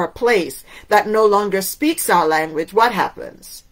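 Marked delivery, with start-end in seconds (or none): none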